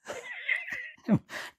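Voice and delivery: high-pitched burst of laughter; squeaky giggle. Real, not acted. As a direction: crack up at the memory. Recalling the rookie mooching over.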